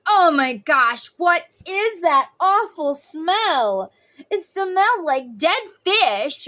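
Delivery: loud, high, drawn-out disgusted outcry; mock disgust. Walker passing something that stinks, hammed-up loud disgust. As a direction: wail it slowly, overdone revulsion.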